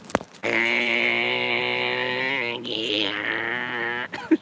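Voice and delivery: long, low mock metal scream.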